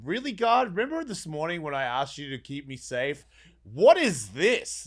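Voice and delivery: high, loud, stretched outcry; exasperated outcry. Retold or imitated. Retelling how he cried out to God at bedtime, loud and exasperated. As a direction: demand an answer from God in disbelief.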